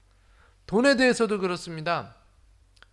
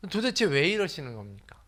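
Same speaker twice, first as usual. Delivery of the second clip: ordinary delivery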